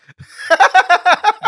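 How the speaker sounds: very high, loud laughter; real shrieking laugh; hard laughing. Real, not acted. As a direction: crack up helplessly. Cracks up at sandwich remark.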